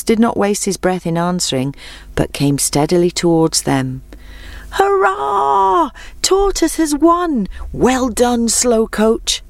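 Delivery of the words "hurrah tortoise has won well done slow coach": high excited cheering crowd. Acted. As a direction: cheer the winner, high and bright. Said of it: "the animals cheered".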